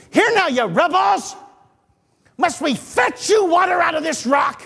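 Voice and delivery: angry shout. Shown as a demonstration. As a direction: bellow it at the grumbling crowd. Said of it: "I don't think he said it like that".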